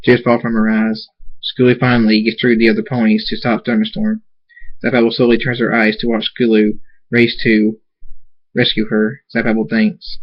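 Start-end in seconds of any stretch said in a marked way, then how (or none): none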